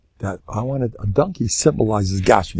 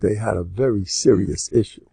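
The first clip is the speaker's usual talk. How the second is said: ordinary talk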